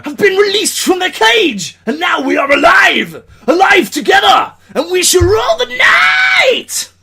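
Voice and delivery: high, loud, slow hammed-up war-leader shout; rally the troops like a mock warlord; mock epic battle cry. Rallying an imagined army in a mock epic battle shout.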